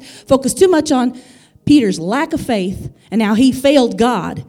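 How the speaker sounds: emphatic preacher with punched key words; impassioned preaching. Real, not acted. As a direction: preach it with conviction to the congregation. Preaches with rising force.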